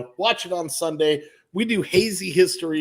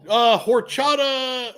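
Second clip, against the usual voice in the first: mock Spanish announcer; 8 semitones higher, 4 dB louder, slower than usual. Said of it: "he's all".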